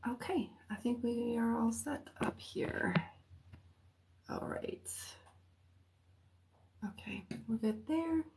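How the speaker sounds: whispering to herself while setting up. Real, not acted. Setting up the stream before going live, whispering to herself. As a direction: murmur under her breath, barely voiced.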